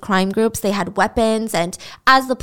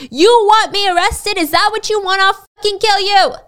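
higher, louder acted fury while kicking him; enraged yelling; 10 semitones higher, 5 dB louder than usual. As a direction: scream threats at the man on the ground. Scammer beats client.

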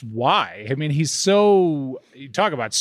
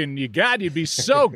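ordinary talk

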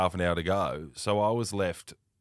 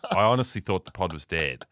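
ordinary talk, nothing marked

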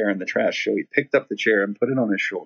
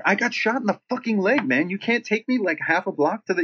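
high-pitched indignant plea; reenacted exasperated begging; pleading injured passenger; 9 semitones higher than usual. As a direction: act out a desperate protest to the driver. Shot man pleads with bus driver.

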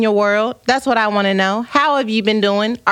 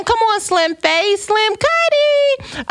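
high, drawn-out playful cheer; playful hype; 11 semitones higher, slower than usual. Real, not acted. Slim-face compliment from a viewer, playful high-pitched hype. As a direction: stretch it out, pitched way up.

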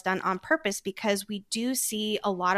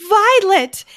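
much higher, much louder cry of the name; worried shout; 12 semitones higher, 13 dB louder than usual. Retold or imitated. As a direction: call her name in panicked relief. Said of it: "The way he goes".

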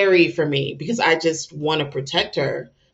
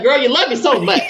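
doting mother voice; 8 semitones higher, 3 dB louder than usual. Retold or imitated. Mother addressing adoring child.

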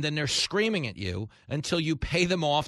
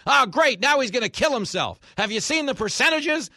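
loud, high sarcastic wail; hammed-up guilt trip about suicide; mock dramatic guilt trip; 10 semitones higher, 7 dB louder than usual. Host imitating activists guilt-tripping, in a loud sarcastic wail.

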